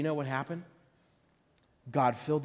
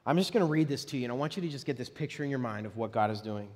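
ordinary talk, nothing marked

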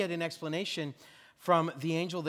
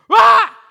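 sudden loud high mock "Ah!" shout; jump scare yell; 20 dB louder than usual. Friend jumping out of the bushes to scare the runner, loud high yell. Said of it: "he'd go".

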